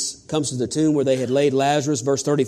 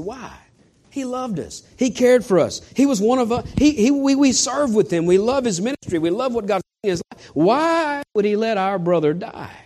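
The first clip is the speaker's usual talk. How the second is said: grieving sisters; 7 semitones higher than usual. Retold or imitated. Grieving sisters voiced in sermon.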